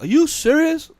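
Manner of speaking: can't believe what he's hearing; exasperated are you serious; incredulous. Retold or imitated. Reacting to being kept out.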